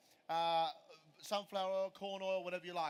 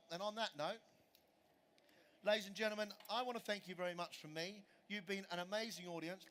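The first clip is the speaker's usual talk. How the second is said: ordinary talk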